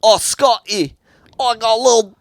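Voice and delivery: high, loud attempt at Scottish; badly faked Scottish, pushed up in pitch; failed Scottish accent. Shown as a demonstration. Trying out how Scots talk, failing Scottish accent. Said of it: "How do Scottish people talk?".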